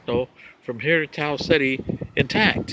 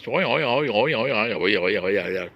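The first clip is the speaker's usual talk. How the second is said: mock signal noise sinking lower; garbled alien signal; 6 semitones lower than usual. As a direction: let the oings slide down in pitch. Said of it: "It sounds like Chinese".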